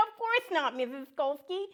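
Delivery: high, cheerful cartoon duck voice; a chirpy little duck saying hello to kids; friendly duck puppet.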